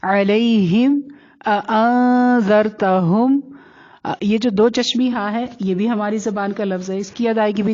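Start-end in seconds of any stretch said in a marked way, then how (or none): 0.0-1.0 علیہم with throaty ع and a soft lay glide. Shown as a demonstration. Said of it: "نرمی سے پڑھے جانے والے". 1.5-3.4 اانذرتہم with the n held long through the nose; ikhfa. Shown as a demonstration. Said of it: "آ پر اخفا ہوگا".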